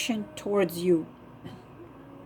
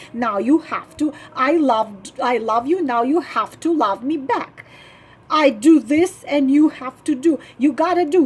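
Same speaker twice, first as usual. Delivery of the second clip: insistent, pushy claim, louder at the start; demanding; 4 dB louder than usual. Retold or imitated. Wife demanding payback from her man, pushy demanding tone. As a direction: press him for what he owes.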